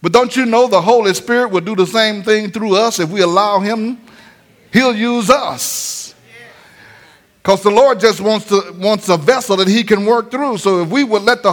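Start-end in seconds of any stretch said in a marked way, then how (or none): 4.7-6.1 drawn out, lingering on each word; preacher slows to stress the promise; slow emphasis. Acted. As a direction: let it sink in slowly.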